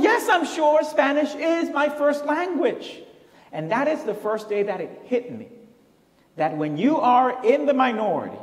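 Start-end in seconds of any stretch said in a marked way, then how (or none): 0.0-3.0 high, louder retold retort; exasperated insistence. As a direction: insist as if stating the obvious. Retold exasperated answer to doubting professor.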